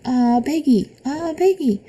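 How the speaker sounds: a scared toddler crying for her aunt, retold; mock crying scream. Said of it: "I hear her crying and screaming".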